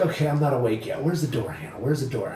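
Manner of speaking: low, soft muttering; retold groggy self-talk. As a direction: mumble to yourself while half awake.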